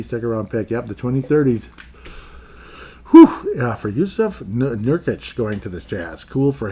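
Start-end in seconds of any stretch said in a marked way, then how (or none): 3.1-3.5 high, loud whistle of relief; loud whew. Real, not acted. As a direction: blow out a big whew.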